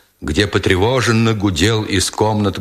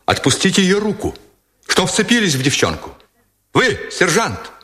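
higher-pitched indignant order; heated command; 8 semitones higher than usual. A narrator's reading. Lieutenant orders sergeant to release the woman, heated high command. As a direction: order him off, still worked up. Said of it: "скомандовал Никитин неостывшим голосом".

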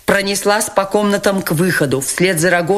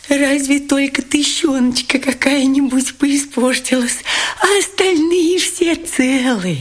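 much higher, fawning, tender tone; touched; 9 semitones higher than usual. A narrator's reading. Lebedev relieved the money survived, tenderly touched. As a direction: coo over the saved money. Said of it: "с умилением выговорил Лебедев".

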